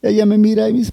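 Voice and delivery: ordinary talk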